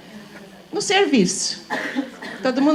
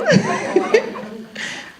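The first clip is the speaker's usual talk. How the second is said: a voiceless laugh, higher; breathy laugh; 10 semitones higher than usual. Real, not acted. Teacher laughing at her own reply, breathy laugh. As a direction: laugh at your own answer.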